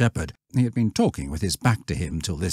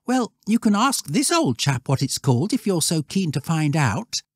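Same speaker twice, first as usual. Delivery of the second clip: put-on high-pitched boy's voice; testy lad, voice well up; retorting schoolboy; 11 semitones higher than usual.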